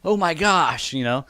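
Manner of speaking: normal talking voice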